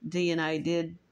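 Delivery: usual speaking voice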